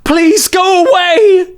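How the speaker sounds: high, slow anguished scream; voiced woman screaming through tears. A narrator's reading. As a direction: cry it out, begging and lashing out. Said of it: "she screamed".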